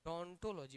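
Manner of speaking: डोंटोलॉजी beginning directly with the D sound; clearly sounded डोंटोलॉजी, first ओ left silent; silent initial O. Shown as a demonstration. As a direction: repeat डोंटोलॉजी with no O in front. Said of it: "ओ silent है यहाँ".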